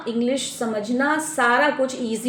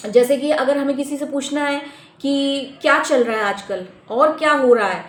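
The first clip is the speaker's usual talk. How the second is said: usual speaking voice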